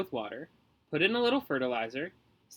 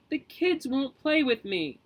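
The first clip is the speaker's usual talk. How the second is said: bawling; 10 semitones higher, 4 dB louder, slower than usual. Acted. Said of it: "he bawled".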